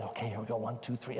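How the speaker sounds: very soft whispered count; hushed countdown. Retold or imitated. Father counting down to the kids before the flip, very soft. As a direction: count down under your breath.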